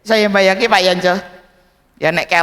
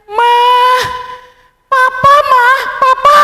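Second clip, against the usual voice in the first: loud, much higher sobbing wail; sobbing child; 8 dB louder than usual. Acted. Crying boy telling his mother about papa, loud high wail. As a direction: wail it like a heartbroken five-year-old. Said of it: "terseduh-seduh".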